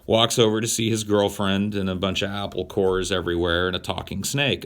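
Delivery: usual speaking voice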